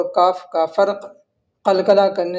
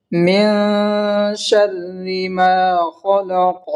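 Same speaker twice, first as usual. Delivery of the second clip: very slow tajweed recitation, long held vowels; measured, chanted Arabic recitation; Quran recitation; much slower than usual. Acted. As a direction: recite slowly and melodically, stretching each long vowel. Recites the next verse.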